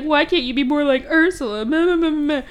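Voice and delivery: high, whiny mocking imitation; nag, then dissolve into mocking nonsense; hammed-up parental complaint; mock nagging. Mimics nagging mother, then mocks.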